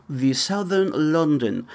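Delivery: put-on refined Southern London English; Southern London accent. Shown as a demonstration. Showing the British accent worth having, in put-on Southern London tones. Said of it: "But if you have".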